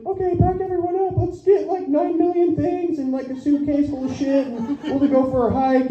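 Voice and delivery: high-pitched, put-on organizing voice; imitated parent rallying the family; mock eager parent. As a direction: rattle it off as a chirpy parent, easing down. Voicing parent organizing family outing.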